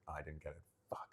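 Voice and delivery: deflated whisper under the breath; hushed, sinking disappointment; crestfallen aside. Retold or imitated. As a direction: quietly whisper the letdown to yourself. Retold private letdown on the call.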